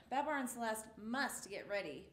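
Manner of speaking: plain reading voice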